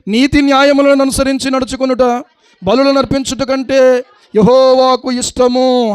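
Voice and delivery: slow, solemn verse reading; scripture reading. Acted. Preacher reading the verse to the congregation, slow and solemn. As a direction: read the verse out slowly and solemnly.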